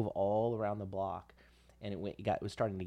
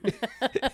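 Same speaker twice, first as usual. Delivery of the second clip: laughing; 6 dB louder than usual. Real, not acted. Laughing after acting dad.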